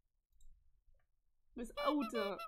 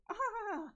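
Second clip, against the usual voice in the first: short shocked scream; 8 semitones higher, 4 dB louder than usual. Acted.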